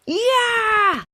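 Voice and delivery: high, loud excited "Yeah!"; a kid's big happy cheer. A narrator's reading. Kids cheering to start the game, excited.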